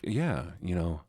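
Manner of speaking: the dog at home with his family, mellow; calm relaxed dog. Retold or imitated. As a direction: lounge like an old dog by the fire. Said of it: "He's like".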